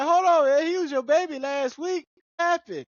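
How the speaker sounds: high, loud indignant voice; high mock protest. Son to his stubborn mama, high indignant protest. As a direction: squeak out a wronged protest.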